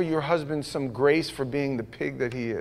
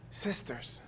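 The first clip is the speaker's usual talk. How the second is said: whispered; 6 semitones lower, 21 dB quieter than usual. Real, not acted. Pastor addressing women softly.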